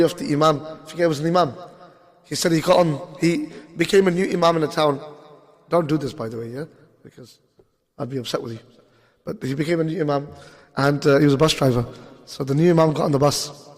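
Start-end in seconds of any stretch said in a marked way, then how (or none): 5.7-8.6 quiet aside. Real, not acted. Aside to audience mid-story.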